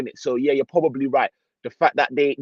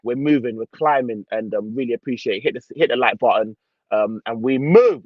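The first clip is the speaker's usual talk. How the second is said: ordinary talk, nothing marked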